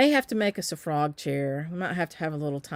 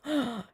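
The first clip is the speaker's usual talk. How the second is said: playful gasp; 6 semitones higher, 4 dB quieter than usual. Real, not acted. Reacts to a viewer's comment.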